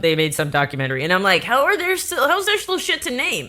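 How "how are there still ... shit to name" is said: high, disbelieving; baffled question, pitched up; incredulous. Retold or imitated. Quoting own incredulity.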